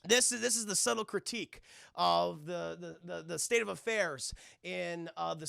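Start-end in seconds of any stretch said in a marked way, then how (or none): none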